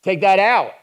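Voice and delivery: loud, high call to take the trash out; wife reminding her husband from the kitchen, loud and high. Retold or imitated. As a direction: call it across the house, loud. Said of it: "the wife, she says".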